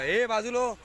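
high call to move aside. Real, not acted. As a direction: call her out of the way. Calling Shruti out of the way. (श्रुतीला बाजूला होण्यासाठी उंच आवाजात हाक)

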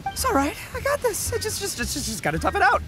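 strained high voice. Acted. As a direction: voice cracking up high, forced calm.

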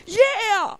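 high, loud mock cry; drivers agreeing. All the drivers backing up the cop, emphatic mock yell. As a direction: holler agreement along with the cop. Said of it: "And we're all like".

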